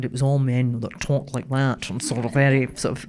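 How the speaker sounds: low, lightly put-on old newsreader voice; Fife Robertson. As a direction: mimic an old-time radio newsman. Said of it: "I'm sounding a bit like Fife Robertson".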